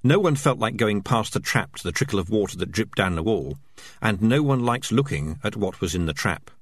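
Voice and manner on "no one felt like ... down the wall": ordinary delivery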